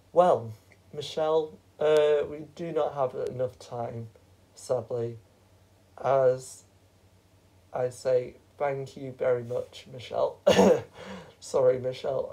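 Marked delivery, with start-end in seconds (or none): none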